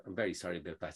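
low, hushed retold apology; calm apology. Airline worker following passengers out to apologise, calm.

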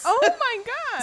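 high, slow gasp of disbelief; shocked gasp. Real, not acted. Friend hears the crash story, shocked and slow. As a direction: draw it out in shock.

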